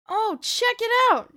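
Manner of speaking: high, loud, drawn-out excitement; excited exclamation, pitch leaping up. Acted. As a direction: call out a thrilling find. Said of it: "she says excitedly".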